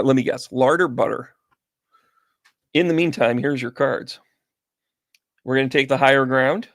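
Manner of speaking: usual speaking voice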